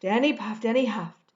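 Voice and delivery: plain reading voice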